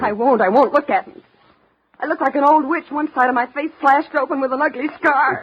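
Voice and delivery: high, loud, anguished protest; distraught cry of self-loathing. Acted. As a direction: plead against the mirror in despair. Disfigured woman despairs, rising pitch.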